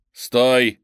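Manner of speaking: loud barked command; shout. A narrator's reading. Narrator shouting at the approaching woman, loud command. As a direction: stop him with a sharp shout. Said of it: "крикнул я".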